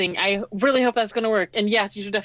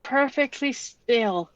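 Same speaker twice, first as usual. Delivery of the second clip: slow, halting warning; tense warning; 4 semitones higher, much slower than usual. Acted. Camper warns companion as the creature reaches out, tense warning. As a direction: draw it out, with long pauses between words.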